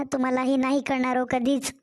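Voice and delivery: usual speaking voice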